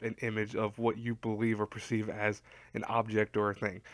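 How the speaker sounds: ordinary talk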